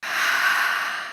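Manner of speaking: long, soft, airy sigh; gentle breath out; demonstrated exhale. Shown as a demonstration. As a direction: release the breath slowly. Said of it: "Breathe in again".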